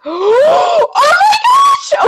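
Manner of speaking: gasp, then a high shouted squeal; fangirl gasp. Real, not acted. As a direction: gasp and shriek with delight. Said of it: "my fangirl's freaking out".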